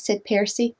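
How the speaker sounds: plain reading voice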